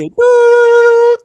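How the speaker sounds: high squeak. Retold or imitated. Stunned answer to who's her daddy.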